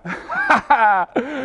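mocking ha-ha-ha laugh; teasing laugh. Real, not acted. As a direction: laugh at your own jibe. Laughs after teasing about peeping.

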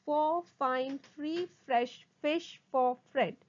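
slow, distinct words; slow tongue twister. Shown as a demonstration. Teacher models a twister for students, slowly. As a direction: give each word its own space. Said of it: "first try saying them slowly".

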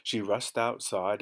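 usual speaking voice

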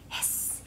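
long unvoiced hiss. Shown as a demonstration. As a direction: hiss like a snake. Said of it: "They go hiss".

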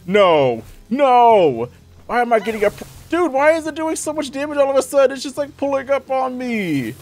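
high, loud wail of protest; dismayed protest. Real, not acted. Player suddenly taking heavy damage from the boss, dismayed high wail. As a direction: cry out in high-pitched panic at losing.

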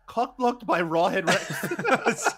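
slow, drawn-out mock complaint; mock outrage. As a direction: stretch it out in fake frustration. Mock frustrated lament.

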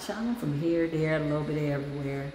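low, soft and coy; coy reply. Retold or imitated. Dancer answering admirers, low and coy. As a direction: answer low and mysterious, teasing them. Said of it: "I'm like".

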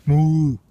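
a loud, high moo; cow moo. Pretend. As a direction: moo loudly at the worm like a cow. Moos at a worm.

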